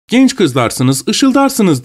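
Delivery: lightly voiced pun, ışıldarsınız rising high; quoting the pun. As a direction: recite the rhyme with a playful lift. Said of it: "diyor bize".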